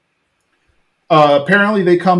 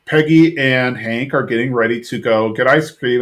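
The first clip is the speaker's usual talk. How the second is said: usual speaking voice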